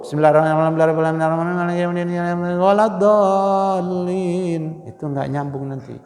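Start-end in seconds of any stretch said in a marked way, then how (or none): 0.0-4.6 hurried mumbled Fatihah run together; gabbled recitation that doesn't connect; rushed recitation. Shown as a demonstration. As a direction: rush through it carelessly. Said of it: "Jangan terburu-buru".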